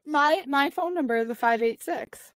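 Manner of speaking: ordinary talk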